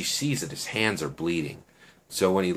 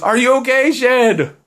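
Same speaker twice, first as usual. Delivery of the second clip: high, loud cry of concern; alarmed call; 8 dB louder than usual. Acted. Annie calls to Shed after his fall, alarmed shout. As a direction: call out urgently to a friend up high.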